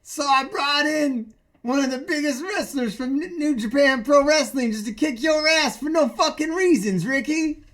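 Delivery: heel taunt. Pretend. Heel wrestler mocking Ricky Starks, gloating. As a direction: taunt him like a smug wrestling villain.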